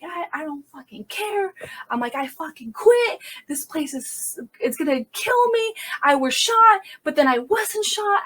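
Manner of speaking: high-pitched, worked-up venting. Retold or imitated. Officer venting to her sergeant, high and worked up. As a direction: re-enact a cursing outburst, pitch climbing. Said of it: "I'm just, like, venting".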